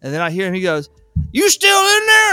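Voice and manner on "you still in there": very high, drawn-out mimicked shout. Husband checking on the technician below, slow high shout.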